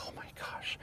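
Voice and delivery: breathless whispered alarm; panicked whisper. Retold or imitated. Teacher realizes she's still choking, whispered panic. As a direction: whisper it in dread. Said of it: "And I was like".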